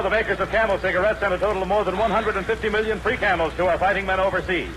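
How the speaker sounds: usual speaking voice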